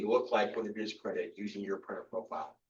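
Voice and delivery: usual speaking voice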